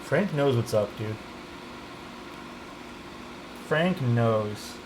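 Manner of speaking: normal talking voice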